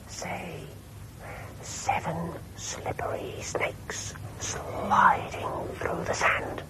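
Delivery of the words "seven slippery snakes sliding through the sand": slow whisper, "Seven slippery snakes" with each s hissed long; drawn-out s like a snake. Shown as a demonstration. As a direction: stretch every s in "slippery snakes sliding" like a hiss. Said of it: "sounds a bit like a snake hissing".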